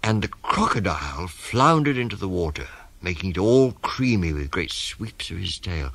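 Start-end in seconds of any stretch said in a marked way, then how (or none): none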